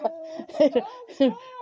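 loud, high laugh. Real, not acted. Amused at the dog's new name, loud laughter. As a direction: burst out laughing loudly. Laughs at renaming Blue Patrice.

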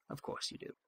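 whispered knowing aside; wry whisper. Real, not acted. Player aside on the rivals, whispered and wry. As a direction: breathe it with a knowing smirk.

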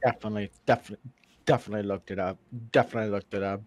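low, soft, repetitive character voice; quoting a comedy bit. Acted. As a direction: keep repeating it in a low, muted voice. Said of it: "Are you quoting the g".

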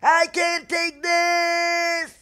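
high, drawn-out wail of despair; hammed-up anguished shout; melodramatic despair. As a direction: cry out as if your grand plan is ruined. Melodramatic despair at ruined plan.

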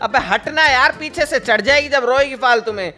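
shouting back. Acted. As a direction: yell at her to get out of the way. (अबे हटना कहते हुए चिढ़कर चिल्लाना)

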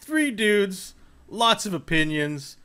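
slow announcer welcome; podcast host intro. Acted. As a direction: open the show like a host.

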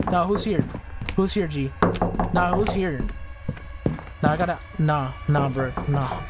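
ordinary delivery

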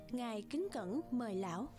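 plain reading voice

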